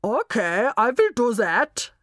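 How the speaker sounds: put-on obedient reply in a German accent. Knockman obeying Molly's order, German accent. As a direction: switch to a thick German accent. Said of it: "you will talk in a German accent".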